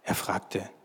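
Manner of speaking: usual speaking voice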